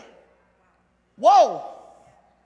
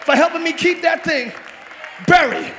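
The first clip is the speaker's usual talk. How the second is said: preaching at full force; 8 dB louder than usual. Acted. Preacher rallying the church to throw their stones, shouting. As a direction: whip up the congregation.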